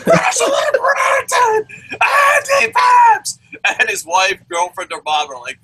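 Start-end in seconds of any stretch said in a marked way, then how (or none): 0.1-3.4 shrill high screaming, drawn out and slow; mock screaming fan. Fan screaming names at his computer, hammed-up shout. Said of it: "puts a capital letter screaming".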